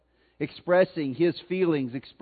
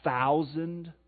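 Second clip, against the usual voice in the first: ordinary talk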